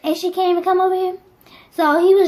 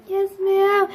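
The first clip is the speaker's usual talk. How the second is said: high little whimper; meek pretend crying answer; mock tearful; 5 semitones higher than usual. Crying child answering scolding mom, mock tearful. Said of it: "I start crying more".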